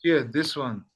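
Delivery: usual speaking voice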